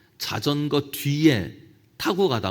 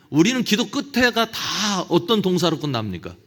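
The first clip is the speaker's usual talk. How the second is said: usual speaking voice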